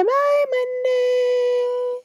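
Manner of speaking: high, slow sing-song farewell; sing-song goodbye. Shown as a demonstration. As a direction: wave it off in a high lilting voice. Waving goodbye to Monday.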